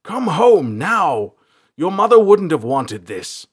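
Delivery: loud, high paternal command; urgent, pleading shout. A narrator's reading. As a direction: order your daughter home at full voice. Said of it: "he shouted".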